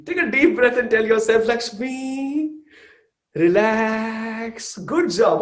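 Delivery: high singsong coaxing; acted self-soothing. Mother telling herself to relax while baby cries, singsong self-soothing.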